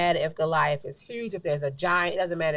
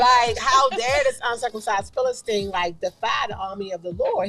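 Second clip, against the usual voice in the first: indignant retelling; 5 semitones higher, 6 dB louder than usual. Retold or imitated. Retelling David's indignant challenge.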